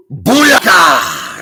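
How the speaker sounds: sudden loud shout to startle; scare shout. Pretend. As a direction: jump out and yell it. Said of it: "I need someone to go".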